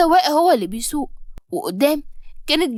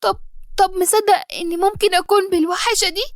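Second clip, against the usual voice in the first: tearful plea; 7 semitones higher than usual. Acted. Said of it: "ودموعي نازلة".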